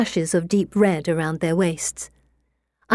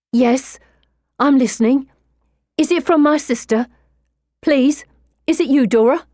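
higher, louder eager plea; desperate hopeful man at a séance; 7 semitones higher, 4 dB louder than usual. Acted. Eager man calls to his sister.

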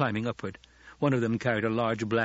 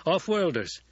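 higher, voiced-out character line; spoken aloud; 7 semitones higher, 3 dB louder than usual. A narrator's reading. Gurney tells Paul about new recruits, higher speaking voice. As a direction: say "Off-worlders" aloud, pitched up.

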